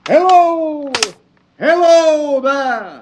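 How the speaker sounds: long, high hail pitched far up; calling out. Acted. Stranger in the dooryard hails the shack, high drawn-out call. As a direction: hail the house from a distance.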